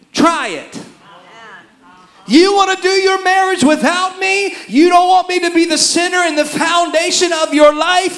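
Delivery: high, loud defiant dare; God's angry challenge. Acted. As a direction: dare the marriage to go it alone. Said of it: "he said to Israel".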